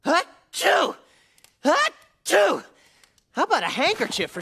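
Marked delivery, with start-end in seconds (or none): 0.1-2.6 high, sharp double sneeze. Acted. Hedgehog with a cold sneezes twice at the genie.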